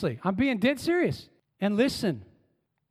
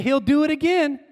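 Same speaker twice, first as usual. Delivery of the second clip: call and response; 6 semitones higher, 5 dB louder than usual. Shown as a demonstration.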